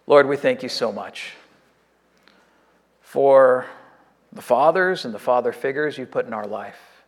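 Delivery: earnest, measured prayer voice; closing prayer. Acted. Pastor praying for fathers on Father's Day, earnest and sincere.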